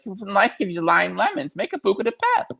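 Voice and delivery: lightly voiced Shannon with rising excitement; mock Shannon Beador. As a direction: pitch it way up like a chirpy pep talk. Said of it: "Shannon Beador is gonna run up in there and be like".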